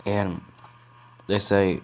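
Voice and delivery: plain reading voice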